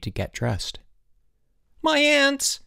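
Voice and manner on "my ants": alarmed high-pitched cry; sudden cry. A narrator's reading. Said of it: "he cried suddenly".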